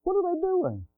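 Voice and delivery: high incredulous mock question; high-pitched imitated shock; mock disbelief. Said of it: "And you say".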